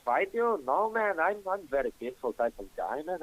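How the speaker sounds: mock shocked refusal; retold alarm. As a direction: back away from the fight, shocked. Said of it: "I was like".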